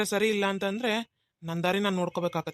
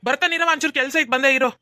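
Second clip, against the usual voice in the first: shouting back; 5 semitones higher, 7 dB louder than usual. Acted. Son shouting back from inside.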